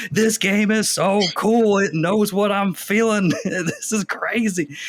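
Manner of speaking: high, slow, blown-away gushing; drawn-out, high-pitched wonder with a laugh; retold amazement. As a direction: relive giddy disbelief, stretching each phrase. Retold amazement at vibrating controller.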